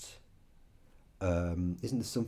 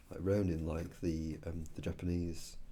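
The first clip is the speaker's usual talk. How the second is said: ordinary talk, nothing marked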